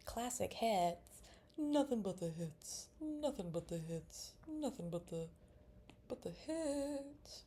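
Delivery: slow, drawn-out radio slogan; radio jingle. Pretend. While drawing, she mock-chants a radio slogan slowly. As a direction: croon it like a station ident.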